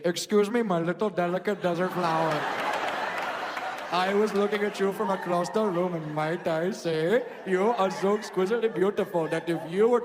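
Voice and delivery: hammed-up Indian accent, courtly flirting; comic Indian-accented suitor. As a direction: woo formally in a mock Indian accent.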